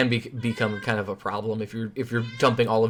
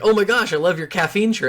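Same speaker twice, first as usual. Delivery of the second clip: high, loud fan-like excitement; delighted, pitched-up exclamation; re-enacted gushing; 8 semitones higher, 5 dB louder than usual. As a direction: gush over a stranger in a raised voice. Acting out delight at stranger's shirt.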